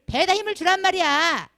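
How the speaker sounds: loud, high mock rebuke; exasperated shouted instruction, acted out; imitated scolding shout. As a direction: imitate an elder yelling at singers. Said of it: "또 이렇게 말씀을 하시는".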